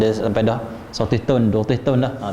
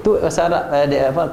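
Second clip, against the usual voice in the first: usual speaking voice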